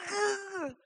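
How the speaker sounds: very high held squeal of anticipation; giddy squeal. Acted. Gift giver hiding a present, giddy high squeal. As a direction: squeal with pent-up giddiness. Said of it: "I get giddy".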